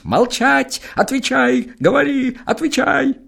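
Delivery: very high, piercing interrogation; shrill shouting gendarme. Acted. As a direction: scream it at him like an angry gendarme. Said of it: "кричит пронзительным голосом". (жандарм пронзительно кричит на Серапиона)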